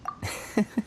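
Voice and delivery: a high, breathy little laugh; quiet amused chuckle; soft chuckle. Acted. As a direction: laugh softly to yourself, pleased. Character chuckles after a revelation.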